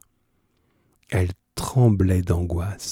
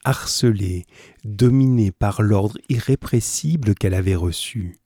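plain reading voice

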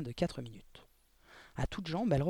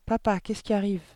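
higher, louder, worried demand; alarmed question; 5 semitones higher, 5 dB louder than usual. A narrator's reading. Girl questioning her father amid the packing, alarmed. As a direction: ask Papa urgently, voice raised.

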